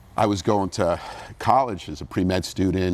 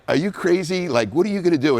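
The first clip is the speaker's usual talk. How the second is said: raised, higher-pitched incredulous dad; upset dad; 11 semitones higher, 4 dB louder than usual. Retold or imitated. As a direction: imitate an upset father scolding his kid. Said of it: "they were really upset with me".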